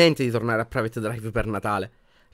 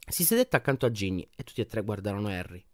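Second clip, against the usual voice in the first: ordinary delivery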